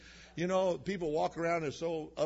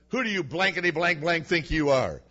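a mock angry snap, a bit louder; angry retort; 4 semitones higher, 5 dB louder than usual. Preacher retells rude customer's anger at him, mock angry. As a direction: throw the man's insult back, lightly acted. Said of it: "He got really mad".

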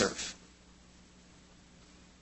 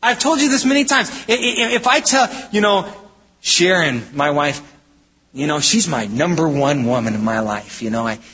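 normal talking voice

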